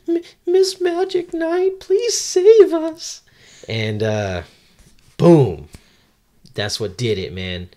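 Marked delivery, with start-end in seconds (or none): 0.1-3.2 put-on very high, loud little-girl voice; little girl pleading. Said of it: "it's a little girl, and she's like". 5.2-5.7 sudden loud exclamation; loud boom. Acted. Sound effect for the climax.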